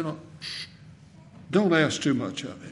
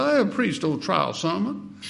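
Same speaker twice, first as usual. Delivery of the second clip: imitated South Carolina accent, pitched up; higher-voiced South Carolina impersonation; 4 semitones higher than usual. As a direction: quote the old preacher in his South Carolina accent. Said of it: "had that South Carolina accent".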